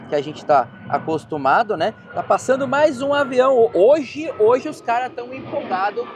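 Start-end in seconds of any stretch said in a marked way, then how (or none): 2.1-6.0 raised voice. Real, not acted. Talking over passing airplane.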